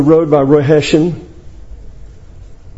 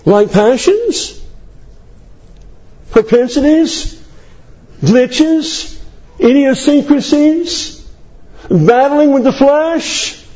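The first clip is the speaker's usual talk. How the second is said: rhetorical questions; 6 semitones higher, slower than usual. Acted. Preacher listing human failings rhetorically.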